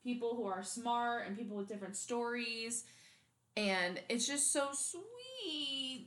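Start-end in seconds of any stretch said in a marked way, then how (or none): 3.6-6.1 high, drawn-out coo over something cute; gushing. Real, not acted. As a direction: stretch it slowly into an adoring squeal.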